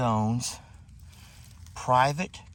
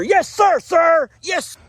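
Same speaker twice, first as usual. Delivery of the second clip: shouted, much higher yes sir; soldier's reply; 6 dB louder, slower than usual. Acted. Vlogger playing a recruit answering himself, shouted high. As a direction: snap back like a recruit.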